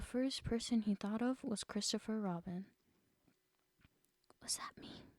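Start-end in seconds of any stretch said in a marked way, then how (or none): 4.4-5.1 hushed, wide-eyed disbelief; awed whisper. A narrator's reading. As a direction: breathe it out in awe. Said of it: "in an awed voice".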